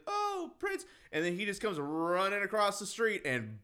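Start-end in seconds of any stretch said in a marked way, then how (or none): 0.1-0.9 much higher calling out a name; high mock calling. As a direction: call the dog sweetly, high up. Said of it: "waves, like".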